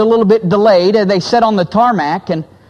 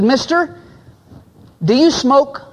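higher, lightly voiced curious child; a hint of a young girl, higher and eager; little girl asking; 4 semitones higher than usual. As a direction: ask it like a nosy ten-year-old. Young girl quizzing a businessman.

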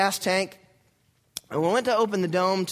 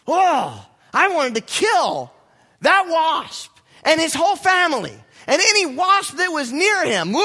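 loud, high hammed-up rage; hammed-up fury at the wasp; mock fury; 9 semitones higher, 5 dB louder than usual. Preacher hams up fury at the wasp that stung him.

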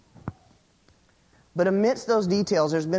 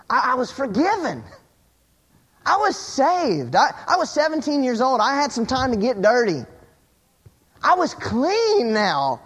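high, emphatic testimony with falling ends; exuberant preaching; 7 semitones higher, 3 dB louder than usual. Real, not acted. Preacher telling church how he was saved, exuberant preaching. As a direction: testify with rising joy, punching the key words.

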